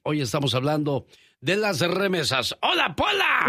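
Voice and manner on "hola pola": saludo agudo y fuerte; llamando fuerte. Real, not acted. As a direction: grita el nombre para llamarla. Llamando a la asistente. (llamando a Pola a gritos)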